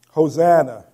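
Hosanna cried out loud, falling at the end; crying out. Acted. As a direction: shout Hosanna as a crowd would. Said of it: "they cried out".

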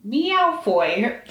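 "Meowfoy" with a high cat's meow first; tipsy mispronunciation. A narrator's reading. Tipsy woman mangling his surname, "Meowfoy" meowed high. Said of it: "frowning in concentration".

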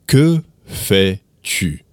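very slow, syllable by syllable; slow demo. Shown as a demonstration. Teacher introducing the formal French question, slowly spelled out. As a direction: space out each French word, "Que ... fais ... tu". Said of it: "the really formal question".

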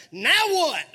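as if they got your latte wrong; exasperated latte complaint. Shown as a demonstration. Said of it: "when they got your latte wrong. That way".

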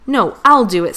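emphatic, leaning on who will go; insistent volunteering. A narrator's reading. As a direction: cut in and claim the job. Scout competes to volunteer.

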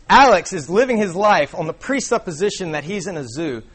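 ordinary talk, nothing marked

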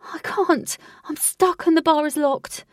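urgent distress. A narrator's reading. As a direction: call out, trapped and alarmed. Trapped Wraith answers urgently.

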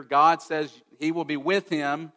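ordinary delivery

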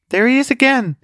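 high, loud, bursting exclamations; eager child spotting the trick; excited little girl. Acted. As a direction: point it out jumping with excitement. Truth points out rabbit.

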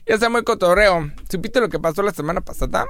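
put-on high, singsong Mexico City accent; hammed-up chilango podcast welcome. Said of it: "cambiar nuestro acento".

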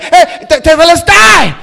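loud, high gladiator cry; victory shout. Acted. Roman gladiator over a defeated enemy, shouting in victory. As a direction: shout it out in triumph. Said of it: "They shouted that it had been defeated".

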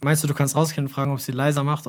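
usual speaking voice

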